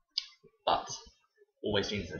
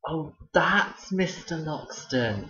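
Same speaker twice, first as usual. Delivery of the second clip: put-on kid in the queue; 7 semitones higher, 4 dB louder, much slower than usual. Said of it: "heard one of the kids in the queue say".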